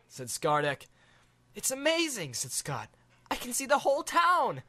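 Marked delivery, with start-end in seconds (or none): 1.6-4.6 put-on much higher, amazed boy voice; wide-eyed kid, high; awed boy. Boy marvelling at the view from the dragon.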